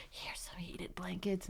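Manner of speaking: secret slow whisper. Retold or imitated. Nurse sneaks blankets to mother at night, whispering.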